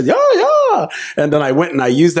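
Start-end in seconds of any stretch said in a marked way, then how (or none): 0.1-0.9 high, squealing yeah, yeah; high excited squeal. Retold or imitated. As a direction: squeal with delight. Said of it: "I was very excited".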